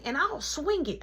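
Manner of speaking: put-on high little-kid voice; high-pitched mock child bravado; eager kid. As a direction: imitate a child offering to fight.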